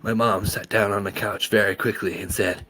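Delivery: ordinary delivery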